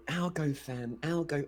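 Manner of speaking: mocking. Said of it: "you know".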